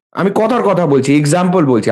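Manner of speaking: normal talking voice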